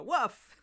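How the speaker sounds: loud, high mock woof; imitated dog bark. Dog talking back to its owner, imitated bark. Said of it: "the dog would go".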